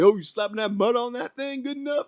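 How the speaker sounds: high mocking judge voice. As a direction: squeak it out like a nosy judge.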